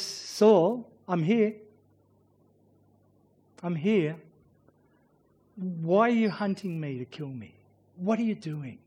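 soft, sinking, pleading call; David calling to Saul, softly pleading; quiet plea. Acted. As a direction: plead gently, voice dropping away.

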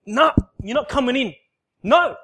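loud, high shouted refusal; defiant refusal. Retold or imitated. Retelling how he shouted God away, loud and defiant. Inward struggle against God.

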